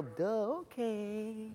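soft, dopey agreement; mock dim-witted, quiet yes; gullible girl. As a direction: go along dumbly, drawing out the okay.

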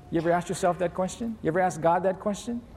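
usual speaking voice

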